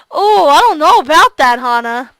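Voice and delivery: [name]'s voice. Acted.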